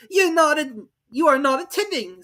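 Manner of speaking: high, loud scolding; severe. Acted. Mouse scolding Alice, high and severe. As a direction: scold sharply. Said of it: "said the mouse to Alice severely".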